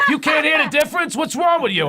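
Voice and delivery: high, loud, incredulous challenge; indignant shouting. Acted. Quoting someone baffled you can't hear party and potty, indignant shout. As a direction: shout it as an exasperated Bostonian.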